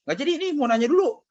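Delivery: high, loud retold exchange; mother and son exchange. Son telling mom at the table he wants to ask, high and loud. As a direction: act out the back and forth brightly. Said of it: "Saya bilang".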